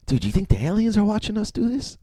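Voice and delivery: high, soft stoner voice; imitating a tripping buddy; stoned friend. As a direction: confide a trippy theory in a high, hushed voice. Stoned friend leans over, asks.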